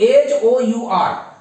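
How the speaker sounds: very slow spelling of एच ओ यू आर; spelling letter by letter. Shown as a demonstration. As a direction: spell each letter out slowly for the class. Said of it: "यहां पर यू है खाली स्थान".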